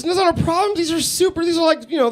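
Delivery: high-pitched defensive explaining; defensive protest. Retold or imitated. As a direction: act out a flustered boyfriend, voice high. Boyfriend defensively explaining in retold quarrel.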